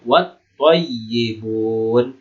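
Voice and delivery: very slow "Wat thayyibun", wat joined straight to th; silent alif lam. Shown as a demonstration. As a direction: recite "Wat thayyibun" without l, stretching yy and the last vowel. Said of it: "alif dan lamnya tidak dibaca".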